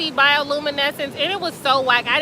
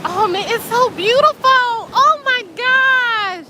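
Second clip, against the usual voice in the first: much higher, very slow, drawn-out gasp of wonder; awed; 10 semitones higher, 3 dB louder, slower than usual. Real, not acted. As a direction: let the wonder stretch out. Admiring the glowing waves.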